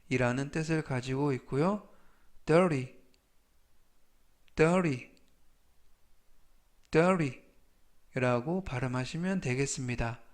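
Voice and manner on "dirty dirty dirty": louder, careful word drill; firm teacher's demonstration with long gaps; slow model reading. Shown as a demonstration. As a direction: project it slowly for learners to repeat. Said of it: "천천히 세 번씩 읽어드리고요".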